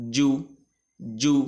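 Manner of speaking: "জু" with a short rounded "oo"; quick pronunciation. Shown as a demonstration. Teacher showing the vowel mark, short "জু". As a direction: say "জু" briefly, without stretching. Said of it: "দ্রুত উচ্চারণ হবে".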